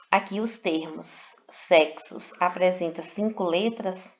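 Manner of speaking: ordinary talk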